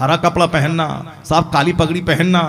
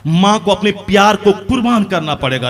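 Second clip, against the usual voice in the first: ordinary talk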